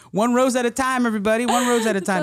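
high emcee crowd call; rally the room like an MC; hype MC voice. Retold or imitated. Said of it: "same wedding energy".

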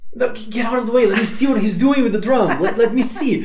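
high, urgent retold protest with laughter; mock-impatient plea, pitched well up; impatient pleading. Impatient watcher shooing dancers away.

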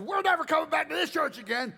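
high-pitched indignant yell; angry walkout. Retold or imitated. Offended man leaving church with his wife, angry yell. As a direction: announce it high as if storming out.

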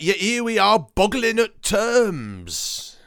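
high, drawn-out mock-posh Cockney; Cockney trying posh. Quoting a villain's great line, hammed-up posh Cockney. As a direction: stretch it grandly, a Cockney putting on airs. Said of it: "he's sort of doing this Cockney, um, who's trying to be posh".

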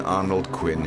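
ordinary talk, nothing marked

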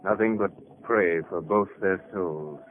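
low, slow, hushed prayerfulness; soft, drawn-out lament; solemn mourning. Acted. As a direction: intone it gravely over the dying. Onlooker mourns two dying men.

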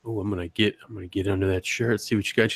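low, soft creepy come-on; mock-courteous creep, voice dropped and hushed; polite creep. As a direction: murmur it like a well-mannered lecher.

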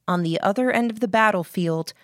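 ordinary delivery

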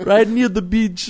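laughing, high, slow mock Jamaican; lightly put-on Jamaican lilt; mock Jamaican accent. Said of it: "What part of Jamaica?".

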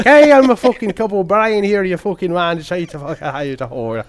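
shouted, high stage-Irish voice; theatrical Irish accent. Retold or imitated. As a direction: belt it like a hammy Irish extra. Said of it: "the theatrical".

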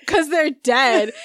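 slow, flat punchline; deadpan reply. Retold or imitated. Telling the movie character's line, slow deadpan. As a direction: drag it out deadpan. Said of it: "And I was like".

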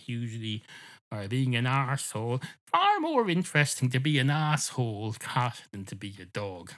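plain reading voice

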